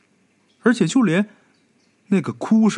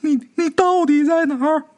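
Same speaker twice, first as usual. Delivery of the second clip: high frantic shout with a stumble; high desperate shout of the girl; 13 semitones higher than usual. A narrator's reading. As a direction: yell into the empty pool, desperate. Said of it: "在泳池里边大喊".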